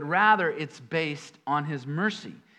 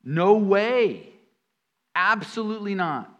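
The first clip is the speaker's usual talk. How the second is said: mock incredulous No way, louder and higher; emphatic retort; 4 semitones higher, 5 dB louder than usual. Preacher paraphrasing Paul for the congregation, emphatic. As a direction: punch it out like a friend scoffing. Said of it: "We might say it".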